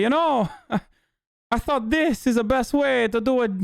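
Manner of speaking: high, excitable cartoon Italian accent. Italian immigrant recalling his plumbing start, hammed-up Italian accent. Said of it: "he himself is, like, every, every stereotype".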